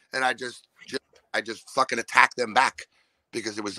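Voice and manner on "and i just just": normal talking voice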